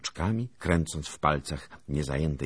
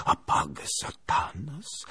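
slow, altered voice warding off temptation; alarmed inner thought; 9 semitones higher, slower than usual. A narrator's reading. Tempted Lithuanian thinks it in panic, slowly warding off. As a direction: drag it out like an exorcism. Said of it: "pomyślał Litwin".

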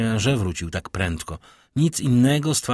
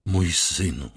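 ordinary delivery

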